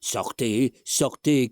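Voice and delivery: high, stamping commands; angry order. A narrator's reading. Old husband orders the intruder out angrily. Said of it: "criait le vieillard".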